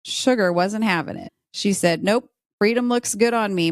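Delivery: ordinary talk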